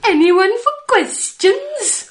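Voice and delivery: drawn-out high coaxing call; high sing-song. Acted. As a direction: stretch it high and slow. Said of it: "make me sound like I've lost my sweet mind".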